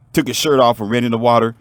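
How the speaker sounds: ordinary delivery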